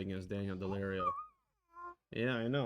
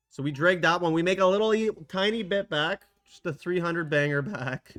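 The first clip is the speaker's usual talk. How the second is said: excited; 6 semitones higher, 8 dB louder than usual. Real, not acted. Excited win.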